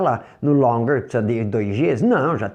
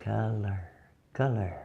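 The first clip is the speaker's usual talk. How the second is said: Color said softly, /kala/, much quieter; quiet demo word; soft model word; 4 semitones lower, 10 dB quieter than usual. Shown as a demonstration. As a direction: murmur Color gently for them to hear. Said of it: "Ouçam bem".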